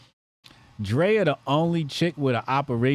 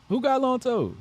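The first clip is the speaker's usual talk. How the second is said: mock question; 13 semitones higher than usual. Repeating chat question, high voice.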